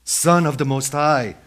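acted demon's outcry; demon crying out. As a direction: hail Jesus at full voice. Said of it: "This is the demon speaking to Jesus".

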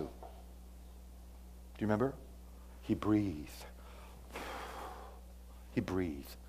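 soft, slow hushed telling; hushed awe. Real, not acted. Preacher hushes as God breathes life into dust.

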